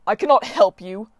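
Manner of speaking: loud anguished refusal; god crying his refusal, loud. A narrator's reading. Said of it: "Apollo cried".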